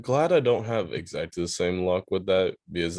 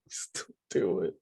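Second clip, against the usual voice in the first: breathy whispered echo; 6 dB quieter than usual. Retold or imitated. Friend echoing the sister in a whisper. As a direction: whisper the line back, laughing.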